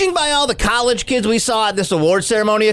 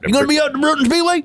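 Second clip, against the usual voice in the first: 1 mock local guy; 6 semitones higher, much faster than usual. Acting local speedway guy.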